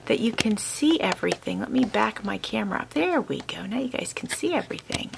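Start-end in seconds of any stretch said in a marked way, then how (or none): 1.6-5.1 breathy aside to the viewers; whisper. Real, not acted. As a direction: drop to a whisper while fixing the camera. Adjusting camera, talks aside.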